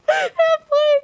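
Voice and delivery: words squeezed out through real laughter; laughing. Real, not acted. Breaking character, laughing at the script. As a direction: gasp it out while cracking up.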